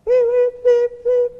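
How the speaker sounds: high, loud electronic bleeping; mock radar bleeps. Joking about scanning the coffee shop, high mock radar bleeps. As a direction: beep like a heat-seeking missile locking on. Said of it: "turned on my heat-seeking missile mechanism".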